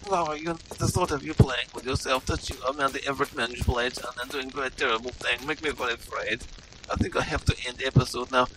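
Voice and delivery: put-on broken English in a heavy Russian-style accent; bewildered Russian-accented gamer; mock Eastern European accent.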